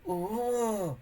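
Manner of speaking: high, breathless little ooh of thrill; a boy gasping at possible treasure; excited ooh. Acted. Boy thrilled by the coffin, excited ooh. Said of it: "chattered Wartyog in excitement".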